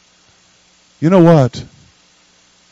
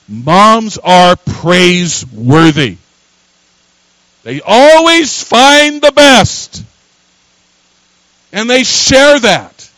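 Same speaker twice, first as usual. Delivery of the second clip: much higher and louder, slow hammered phrases; full-force preaching; 12 semitones higher, 9 dB louder, much slower than usual. Acted. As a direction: preach it to the rafters.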